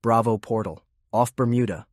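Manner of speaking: ordinary delivery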